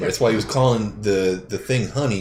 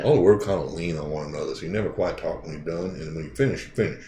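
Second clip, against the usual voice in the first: put-on Texas accent; 5 semitones lower, 6 dB quieter than usual. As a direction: imitate a Texas accent, words leaning together. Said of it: "That's a Texas accent".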